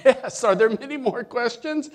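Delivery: man on the branch, high, loud and impatient; eager high yes. Retold or imitated. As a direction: blurt it out, eager for rescue. Eager man answers God.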